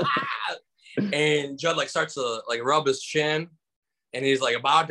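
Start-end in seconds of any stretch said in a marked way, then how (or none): 0.0-0.6 high laughing cry; crowd laugh. Retold or imitated. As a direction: imitate the whole room cracking up. Crowd laughing at his joke.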